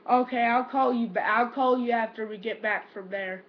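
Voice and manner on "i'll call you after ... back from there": ordinary talk, nothing marked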